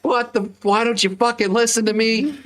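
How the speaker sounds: high, strained retold scolding; exasperated yell. Dad scolding son for not listening, high frustrated cry. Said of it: "And I'm like".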